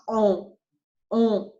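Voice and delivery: French rounded nasal "on", N left silent; isolated demo of nasal "on"; nasal vowel. Shown as a demonstration. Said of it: "you pronounce on".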